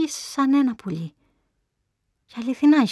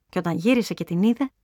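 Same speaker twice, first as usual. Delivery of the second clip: ordinary delivery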